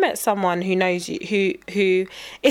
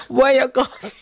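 higher retold mum's question; a hint of mum, higher; mum quoted; 4 semitones higher, 3 dB louder than usual. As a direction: lightly imitate mum asking, pitch lifted. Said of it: "And Mum was like".

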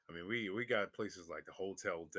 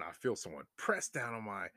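usual speaking voice

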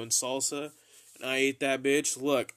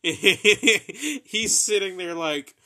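slow, higher, louder, laughing through it; 7 semitones higher, 11 dB louder, much slower than usual. Real, not acted. Laughing while telling of friend's stunned reaction. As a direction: giggle your way through the line.

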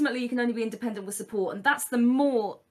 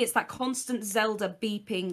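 usual speaking voice

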